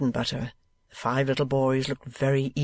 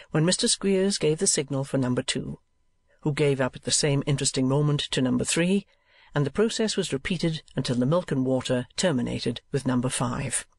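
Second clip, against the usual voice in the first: ordinary delivery, nothing marked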